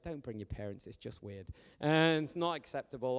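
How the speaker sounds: usual speaking voice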